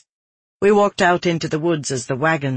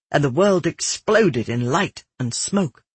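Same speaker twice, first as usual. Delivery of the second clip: plain reading voice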